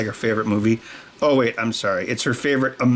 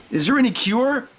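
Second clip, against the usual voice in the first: high, worried mock question; a hammed-up anxious patient; patient asking the doctor for a cure; 9 semitones higher than usual. Patient asks.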